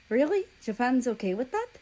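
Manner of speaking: can't believe it; surprised doubting ask; disbelief. A narrator's reading. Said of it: "I asked in disbelief".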